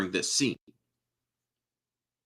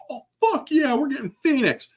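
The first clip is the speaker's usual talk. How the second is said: much higher, thrilled squeal of delight; fanboy excitement. Retold or imitated. Teen fan reacting to the Phoenix tease, high excited gush. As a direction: gush like a teen who just saw the tease. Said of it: "It's like".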